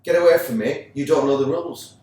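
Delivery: retold ref shooing the skipper away; mock referee yelling. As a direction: bark it like the irate ref.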